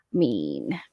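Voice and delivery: low growly "mean"; a deep grumbly pout; mock grumpy. Mock complaint about green.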